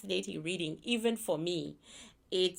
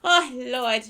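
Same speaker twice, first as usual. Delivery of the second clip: louder, laughing to tears; laugh-crying; 3 semitones higher, 6 dB louder than usual. Real, not acted. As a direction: cackle through it. Laughing hard at her own joke.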